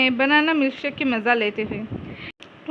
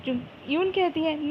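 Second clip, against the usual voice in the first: ordinary delivery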